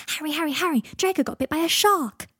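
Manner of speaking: high, loud, breathless news-bringing; excited child. A narrator's reading. Teddy telling Harry about the shark bite, excitedly. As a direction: burst out with thrilling news.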